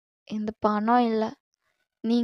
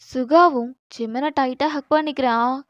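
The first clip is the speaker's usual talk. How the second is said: usual speaking voice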